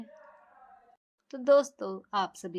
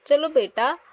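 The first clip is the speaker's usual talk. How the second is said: usual speaking voice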